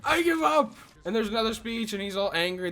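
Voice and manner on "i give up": higher, whiny surrender; mock Jarl Balin. Narrator voicing the beaten jarl's surrender, higher. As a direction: squeak it out like a quitter. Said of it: "he's like".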